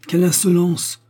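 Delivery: high, loud indignant outburst; haughty exclamation. A narrator's reading. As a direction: exclaim it with lofty disdain. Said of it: "s'écria-t-elle avec hauteur".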